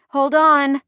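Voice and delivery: high, loud yell; yelled warning. A narrator's reading. Driver warns passengers before impact, yelling. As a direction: yell to brace them. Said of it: "I yell".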